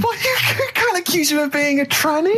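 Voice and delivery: high-pitched shocked objection; scandalised protest. Real, not acted. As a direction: squeal it like you can't believe he said that. Scandalised at co-host's comment.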